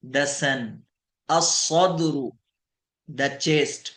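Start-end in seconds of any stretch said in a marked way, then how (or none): 1.3-2.3 असदरु very slow, doubled s, no lam; Arabic sun letter demo. Shown as a demonstration. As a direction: say असदरु as a-ssadru, slowly. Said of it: "स्वाद भी शमसिया में है".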